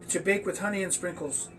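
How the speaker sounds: usual speaking voice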